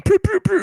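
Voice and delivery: very high loud pew sounds; mock siren pew pew pew. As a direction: fire off cartoon pew noises. Imitated siren noise.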